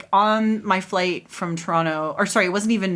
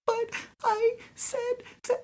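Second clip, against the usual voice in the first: crumbling into tears; 6 dB quieter than usual. Retold or imitated. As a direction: imitate your younger self breaking into a soft sob.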